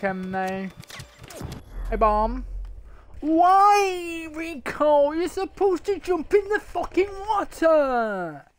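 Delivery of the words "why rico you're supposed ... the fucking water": high, slow nagging whine; mocking whine. Gamer mocking the game's sidekick, high slow whine. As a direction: mimic the NPC in a whiny high voice.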